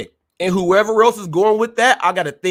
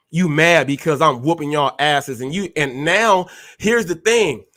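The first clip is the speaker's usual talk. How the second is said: ordinary talk, nothing marked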